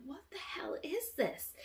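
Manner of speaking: a breathless unvoiced whisper of alarm; hushed horror at a strange find; shocked whisper. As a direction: whisper it in horrified disbelief. Inspecting strange hairs on dog.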